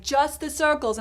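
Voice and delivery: lightly voiced insistent official; European man repeating. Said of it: "he was like".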